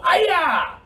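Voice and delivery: high, loud kiai; karate shout. Shown as a demonstration. Teacher finishing the side block song with a shout. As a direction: shout it out big. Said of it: "now we shout".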